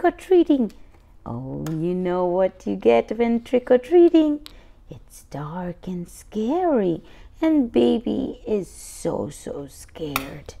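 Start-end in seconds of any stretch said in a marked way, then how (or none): 4.9-7.0 hushed, creepy whisper; storyteller whispering the scary part; spooky whisper. Acted. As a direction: whisper it to build suspense. Spooky trick or treating.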